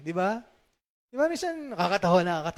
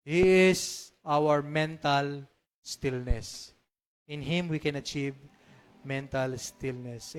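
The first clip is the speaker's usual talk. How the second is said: slow, calm; 5 semitones lower, much slower than usual. Real, not acted. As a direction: let each word settle in stillness. Slow calming preaching.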